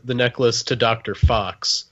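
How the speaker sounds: ordinary delivery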